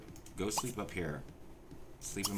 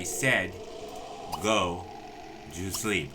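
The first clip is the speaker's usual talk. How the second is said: louder, firm command; loud order; 5 dB louder, much slower than usual. Real, not acted. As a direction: lay down the law, word by word. Ordering the Sim to bed.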